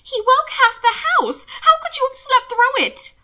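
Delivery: high, loud accusing shout; demanding. Acted. Mina accusing Hannibal, high and shouting. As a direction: hurl the accusation at him. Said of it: "Mina demanded".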